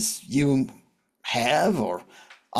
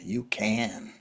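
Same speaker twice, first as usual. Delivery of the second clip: soft whisper of hope; soft whisper urging him on; whispered reassurance; 3 semitones lower, 7 dB quieter than usual. Acted. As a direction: breathe it to him gently. Comforting a man on a bridge.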